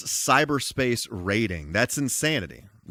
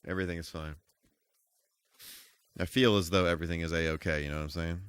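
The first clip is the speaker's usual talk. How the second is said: deadpan irony; 7 semitones lower, 6 dB quieter, much faster than usual. Real, not acted. Ironic after losing health.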